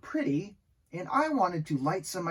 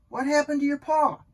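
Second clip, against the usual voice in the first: high, louder storybook question; ladybug friend asking; 9 semitones higher, 6 dB louder than usual. A narrator's reading. Lisa the ladybug asking about Max's paw, high curious question. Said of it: "my friend Lisa the ladybug asked".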